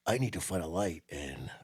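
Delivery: low, hushed TV detective delivery; David Caruso. Retold or imitated. Mocking how he talked on CSI, low hushed cop impression. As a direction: drop to a near-whisper for a corny cop line. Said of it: "He'd go, he'd go".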